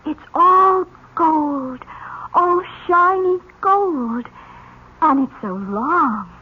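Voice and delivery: dreamy. Acted. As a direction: linger on each word in awe. Slow dreamy over gold gown.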